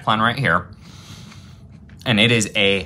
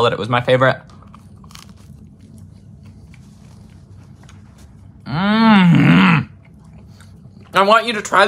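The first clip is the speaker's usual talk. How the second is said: speaking between bites; talking while chewing; 10 semitones higher, 5 dB louder than usual. Real, not acted. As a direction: mouth partly full. Seen 1: Bites sandwich at -4; closed-mouth chewing and wiping mouth to 3.0.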